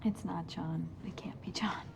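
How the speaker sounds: hushed disbelieving whisper. Acted. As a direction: whisper it, unable to believe. Doctor denies ex is killer.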